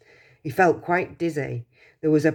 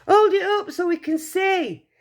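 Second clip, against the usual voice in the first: higher, louder crowd shout; 14 semitones higher, 4 dB louder than usual. Acted. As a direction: call out over the crowd.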